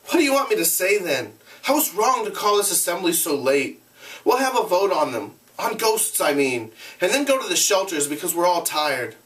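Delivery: lightly voiced anxious boy; a hint of a boy leader, unsure; boy's voice. Boy leader addressing assembly.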